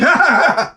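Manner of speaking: high-pitched comic fright cry; mock scared scream. Reacting to a haunted house jump scare, mock scream. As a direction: shriek in mock terror.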